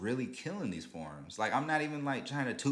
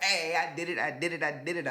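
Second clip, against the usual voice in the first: higher and louder, thrilled; retold joy, voiced higher and louder; excited cheer; 8 semitones higher, 5 dB louder than usual. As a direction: burst out like you just won. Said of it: "I was just happy".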